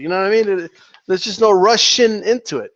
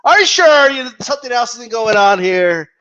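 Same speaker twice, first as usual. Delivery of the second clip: ordinary delivery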